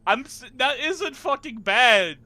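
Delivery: high, loud, drawn-out protest; exasperated loud objection; indignant protest. Real, not acted. Protests at being teased.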